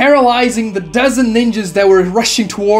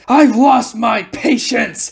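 mock angry scream; 4 semitones higher, slower than usual. A narrator's reading. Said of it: "The captain then screams".

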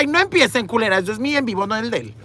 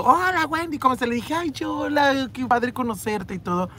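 higher, sweet greeting voice; imitating Jorge; 8 semitones higher than usual. Imitating Jorge Salinas greeting her sweetly. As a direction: play the charming actor saying hello. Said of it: "porque primero me saludó".

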